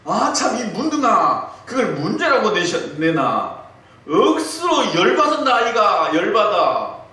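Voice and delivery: 화난 경상도 사투리; angry Gyeongsang husband. Retold or imitated. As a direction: blow up at the wife in Gyeongsang dialect. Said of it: "갑자기 화를 내면서".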